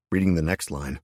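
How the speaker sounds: ordinary delivery, nothing marked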